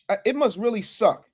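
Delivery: usual speaking voice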